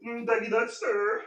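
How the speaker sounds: high sing-song voice for the pup. Real, not acted. Talking sweetly to his dog.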